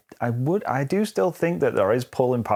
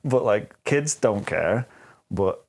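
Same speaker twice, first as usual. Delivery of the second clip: ordinary delivery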